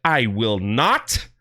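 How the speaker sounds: low, slow, stubborn "I will not"; flat refusal. Retold or imitated. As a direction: dig in and refuse, dragging it out low. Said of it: "She's like".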